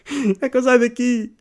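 high, panicky plea for a way out; mock panic, pitched high; panicked cry. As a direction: sound like a scared kid trapped in the bushes. Panicked kid stuck in brambles.

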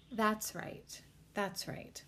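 hypnotist voice. Shown as a demonstration. Demonstrating what hypnotists say to the subject, lulling. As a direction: say it like a hypnosis tape. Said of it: "a lot of them say".

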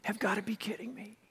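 breathy whispered exasperation; hushed incredulous whisper; whispered disbelief. Retold or imitated. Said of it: "He was like".

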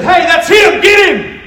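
high, loud shouted command; excited shout. Retold or imitated. Driver in retold story orders friends to attack, shouting. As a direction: shout it like rallying a gang.

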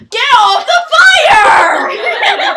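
high, drawn-out mock scream; hammed-up shout. Acting out a classmate's idiotic lab antics, exaggerated shout. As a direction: yell it long, loud and high.

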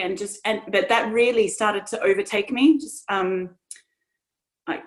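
ordinary delivery

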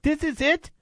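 high mock Irish brogue, laughing at the end; Conor McGregor impression. Host mocking the small bag of cash, in a high Irish voice. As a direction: scoff in a put-on Irish voice. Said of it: "And Conor's like".

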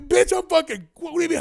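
high and loud, indignant; outraged retort. Retold or imitated. Telling the clerk off in outrage, high and loud. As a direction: blast it back at her, offended.

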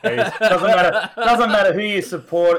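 loud, high, laughing; excited. Real, not acted. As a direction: declare it loudly through laughter. Laughing rant after chant.

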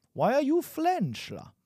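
high-pitched, hammed-up attempt at a French accent; botched French accent. Teacher botching a French accent on an example sentence, mock accent. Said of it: "I wanted to say with a French accent".

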